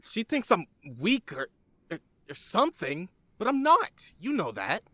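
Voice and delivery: defensive, hurt outburst; frustrated outburst. Acted. Teen vents, feeling treated as weak.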